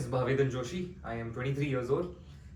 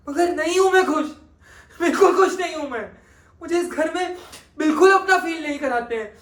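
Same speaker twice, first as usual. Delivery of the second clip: higher, louder anguished pleading, building; pained outburst; 12 semitones higher, 7 dB louder, faster than usual. Acted. Ved crying out that he is not happy in his uncle's house, anguished. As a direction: pour out the hurt of an orphan. Emotional climax of audition monologue.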